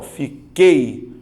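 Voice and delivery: fiquei drawn out slowly and loudly as fi-KEI, no U; slow clear demo. Shown as a demonstration. Teacher modelling the normal fiquei, slow and loud. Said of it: "E aí, normal".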